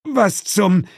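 sudden high disbelief; startled. Acted. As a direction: blurt it out in shock. Sudden shock at devil. (erschrocken aufgeschreckt)

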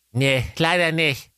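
high mother voice; mother's voice. A narrator's reading. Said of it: "sprach da die Ingeborg Keule".

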